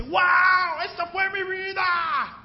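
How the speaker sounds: high, loud cry of wonder; excited shout. Retold or imitated. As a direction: shout the old delight. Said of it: "Ya no me dice". (grito de asombro, agudo y fuerte)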